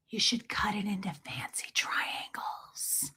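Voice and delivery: slow, very soft secret whisper; mom's whisper. Retold or imitated. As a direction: hush it like a sly tip. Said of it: "she does whisper sometimes".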